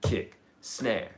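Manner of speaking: ordinary delivery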